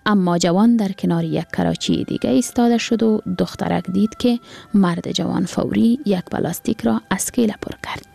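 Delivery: plain reading voice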